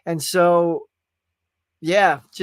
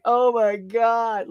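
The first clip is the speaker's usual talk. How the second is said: overwhelmed gasp; 5 semitones higher, much slower than usual. Retold or imitated. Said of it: "I was like".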